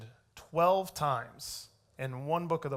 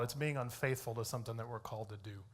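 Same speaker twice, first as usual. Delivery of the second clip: usual speaking voice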